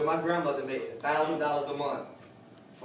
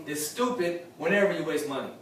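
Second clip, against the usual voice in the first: ordinary talk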